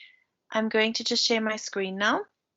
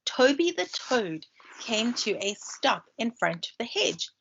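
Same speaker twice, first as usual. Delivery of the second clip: ordinary delivery, nothing marked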